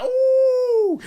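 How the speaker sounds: high impressed ooh; mock a manager's impressed coo; imitating Pep. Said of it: "Pep's like".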